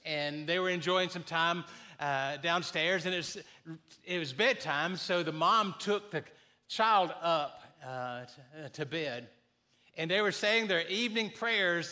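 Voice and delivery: normal talking voice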